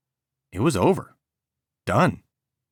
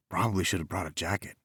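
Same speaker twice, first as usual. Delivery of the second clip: quiet inner thought; 7 dB quieter, faster than usual. A narrator's reading. Inner thought on cold beach.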